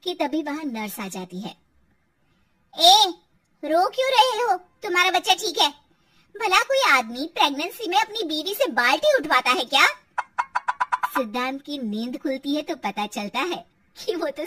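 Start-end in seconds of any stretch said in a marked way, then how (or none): 2.8-9.9 higher-pitched indignant scolding; scolding nurse. Acted. Nurse telling off a crying husband, indignant scolding. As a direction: scold with raised, incredulous questions.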